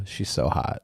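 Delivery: put-on vocal fry teen girl; lightly voiced high school girl. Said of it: "sound like a high school girl when you say it".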